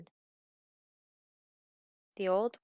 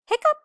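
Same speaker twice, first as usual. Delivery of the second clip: high, loud squeaky hiccups; lamb hiccups; 12 dB louder than usual. Acted. Lamb with hiccups, loud high hiccups.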